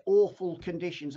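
ordinary delivery

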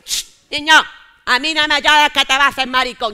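put-on loud, scolding old grandmother; stern grandma. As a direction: shush the crying boy firmly. (abuela regañando en voz alta al niño que llora)

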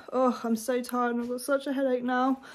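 ordinary talk, nothing marked

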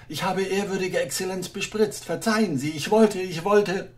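nervous little official begging; timid clerk. Acted.

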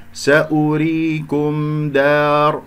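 سأريكم دار slowly chanted, م closed distinctly before د; clear meem. Shown as a demonstration. Teacher making meem heard, slow chant with clear م before دار. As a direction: let the م of سأريكم sound plainly. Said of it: "اسمع الميم المظهرة".

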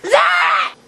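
high, loud furious shout. Acted. Roommate Zach admits eating them, furious shout. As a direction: yell his name in outrage.

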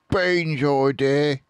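little dwarf blaming his mate; Bunce sneering. A narrator's reading. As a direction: spit out the blame slowly. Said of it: "said Bunce".

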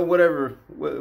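ordinary talk, nothing marked

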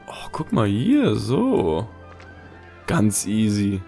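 normal talking voice

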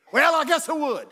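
high, loud swaggering answer; hammed-up heckler's yell. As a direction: holler it back like a cocky heckler. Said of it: "the heckler looked up and said".

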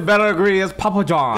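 mock Italian accent. Teasing the friend's Papa John ads, Italian accent. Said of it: "You sound like fucking Mario".

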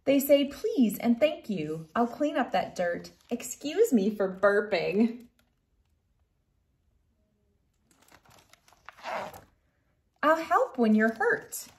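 gentle storybook reading voice; reading to children. Acted. Reads picture book aloud.

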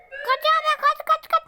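high, loud panicked shout; panicked yelling. Real, not acted. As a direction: scream at them to run. Panicked fleeing in game. (panik içinde bağırarak)

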